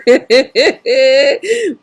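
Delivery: falling chuckle; a real easy laugh. Real, not acted. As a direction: laugh it off as you greet. Laughing with live guests.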